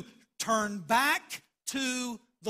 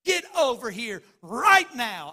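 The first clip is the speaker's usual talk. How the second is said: much louder mock order; angry mock yell; 8 dB louder than usual. Mother ordering her son over, mock angry yell. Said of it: "she was sore displeased".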